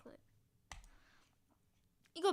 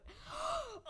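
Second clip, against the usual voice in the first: gasp. Real, not acted. Recognising a reference.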